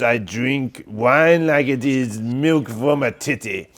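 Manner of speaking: slow, hammed-up French accent; playing a Frenchman from Toulouse, slowly; mock French accent. Joking about wine, slow mock French accent. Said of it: "we can do some French".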